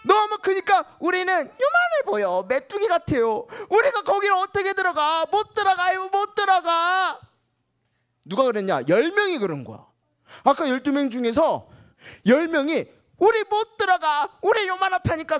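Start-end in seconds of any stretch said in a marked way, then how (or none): 3.7-7.2 fearful refusal. Acted. Ten spies refuse to enter. 13.2-15.4 high, scared; fearful whine. Acted. Ten spies' fearful report.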